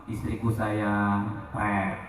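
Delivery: husband sweetly calling his wife, mock tender; tender sweet talk. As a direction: coo it gently to your wife. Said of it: "ngomongnya lemah lembut".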